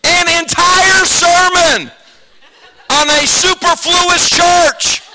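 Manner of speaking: high, loud, slowed preaching; slow, emphatic sermon cry; preaching big. Retold or imitated. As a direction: proclaim it from the pulpit. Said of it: "I'm waxing eloquent".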